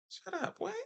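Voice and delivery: high squeaky mock disbelief; a sudden high squeal of fake surprise; mock shock. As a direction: play shocked at your own news. Mock disbelief at own words.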